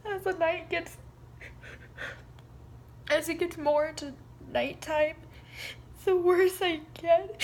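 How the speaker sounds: high voice, halting with sobs; tearful, choked-up dread; fighting back tears. Real, not acted. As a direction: struggle to explain through real crying. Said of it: "try not to cry".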